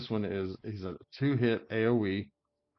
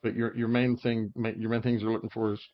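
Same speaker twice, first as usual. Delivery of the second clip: ordinary delivery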